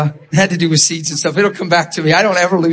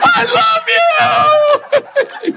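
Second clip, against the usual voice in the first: squealed, very drawn-out mock sob; wailing kid; much slower than usual. Boy calling to his friend mid-run, hammed-up sobbing cry. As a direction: stretch it into a high comic bawl. Joke line in the wailing kid's voice.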